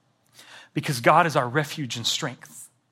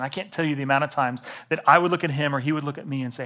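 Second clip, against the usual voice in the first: ordinary talk, nothing marked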